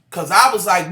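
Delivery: ordinary talk, nothing marked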